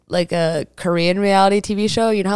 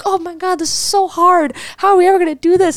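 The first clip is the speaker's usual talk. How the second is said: high, overwhelmed complaining; whiny celebrity; 11 semitones higher than usual. Retold or imitated. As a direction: whine like a struggling star. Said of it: "the celebrity's like".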